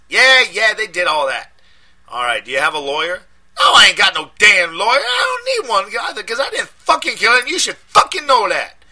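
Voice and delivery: high, loud angry street voice; defiant shout. A narrator's reading. Deshaun on the phone shouting that he's innocent. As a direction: shout it, insulted.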